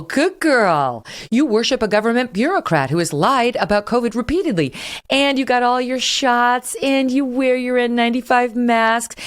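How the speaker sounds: patronizing coo, as if praising a dog; mocking pet praise. Host mocks TV anchor as an obedient pet, patronizing praise.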